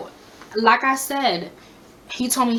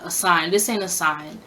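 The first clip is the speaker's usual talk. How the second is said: usual speaking voice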